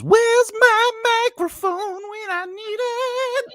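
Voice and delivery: very high, drawn-out singsong squeal; mock audition squeal. Voice actor joking about auditioning for audiobooks, high squeal. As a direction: squeal it slowly like a silly audition.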